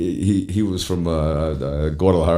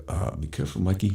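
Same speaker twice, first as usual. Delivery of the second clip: quiet warning; 11 dB quieter than usual. Retold or imitated.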